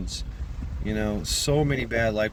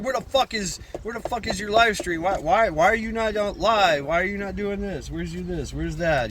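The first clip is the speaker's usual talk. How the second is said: pissed-off fans; 8 semitones higher than usual. Retold or imitated. Imitating angry fans demanding the live stream. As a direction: impersonate an irate viewer demanding answers. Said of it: "they get a little pissed".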